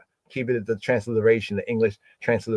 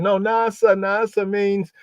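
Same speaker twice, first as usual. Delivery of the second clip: pushing back; 7 semitones higher than usual. Acted. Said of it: "still gonna be pushing".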